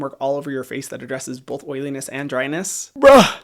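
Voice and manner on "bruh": loud exasperated bruh; shouted bruh. Real, not acted. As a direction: blurt it out in a loud exasperated yell. Exasperated outburst.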